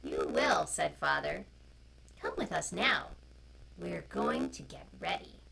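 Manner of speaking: loud, slow storybook reading; reading to children. A narrator's reading. Storyteller reading a picture book to children online. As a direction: read it out to the little ones, clearly.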